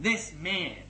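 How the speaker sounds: usual speaking voice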